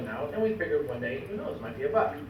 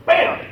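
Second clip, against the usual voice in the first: gunshot noise; 9 dB louder than usual. Retold or imitated. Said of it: "my grandpa takes the rifle and goes".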